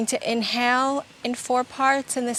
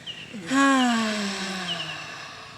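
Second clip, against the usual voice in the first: audible sighing breath out; long exhale sigh; 3 semitones lower than usual. Shown as a demonstration. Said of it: "Exhaling with a sigh".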